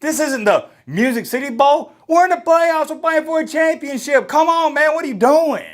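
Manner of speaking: high, loud scolding of a teammate; imitated in-your-face chewing out; in his face. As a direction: get in his face and demand focus. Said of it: "I just got up in his face saying".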